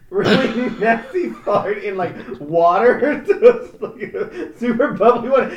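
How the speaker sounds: high, loud giggly retelling; real cracking up; laughing while talking. Real, not acted. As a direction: struggle to talk through laughter. Cracking up while retelling silly video.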